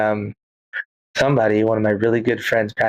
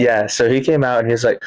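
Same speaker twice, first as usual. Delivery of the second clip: ordinary talk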